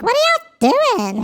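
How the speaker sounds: high, silly mock incredulous voice; stupid voice. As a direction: squeak it like a baffled onlooker. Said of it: "talking in these stupid voices".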